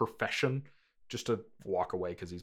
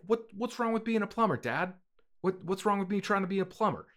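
high, fast, indignant whine; Mario as protesting son; 11 semitones higher, 4 dB louder than usual. Acted. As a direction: whine at Dad in a high, hurried voice. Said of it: "Like, if he's like".

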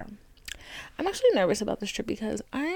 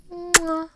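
loud higher smacking kiss; air kiss; 8 semitones higher, 4 dB louder than usual. Real, not acted. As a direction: blow a big kiss at the mic. Blowing listeners a kiss.

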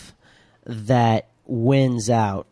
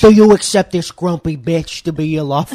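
mafioso wedding officiant; 2 semitones higher, 6 dB louder than usual. Retold or imitated. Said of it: "It's like a mafioso Italian wedding".

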